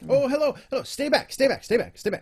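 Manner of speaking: high, loud, rapid-fire startled cries; frantically ward off a stranger, voice shooting up; panicked warning. Acted. Startled Aarakocra warns off a stranger walking up, high panicked shouts.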